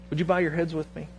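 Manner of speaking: slow, soft pastoral voice; quiet prayer lead. Acted. Leads prayer, softly.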